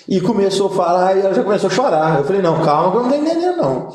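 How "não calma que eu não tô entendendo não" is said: flustered plea. Retold or imitated. Calming his crying mother.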